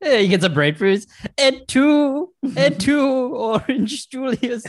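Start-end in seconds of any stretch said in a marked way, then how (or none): 1.4-4.6 high, drawn-out tragic cry; dramatic betrayal. Acted. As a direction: play the betrayed emperor.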